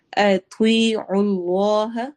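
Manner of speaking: اطیعوا Allah with a thick ط and a stretched ی; heavy ta, long ya. Shown as a demonstration. Teacher demonstrating اطیعوا, very slow. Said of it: "طوا موٹا ہے".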